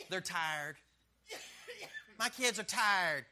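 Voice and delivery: trage, lacherige ouder die excuses verzint; excusing parent. Retold or imitated. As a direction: traag en lacherig de kinderen verontschuldigen. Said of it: "Excusing behavior".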